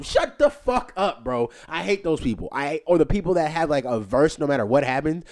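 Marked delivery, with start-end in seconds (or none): 0.0-1.5 much higher, louder, slow and drawn out; slow loud retort. Acted. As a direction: spit each word out slowly.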